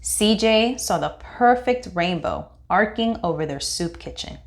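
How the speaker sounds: usual speaking voice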